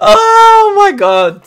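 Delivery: high, loud, slow crying moan; anguished wail. Real, not acted. Loss of seven goals.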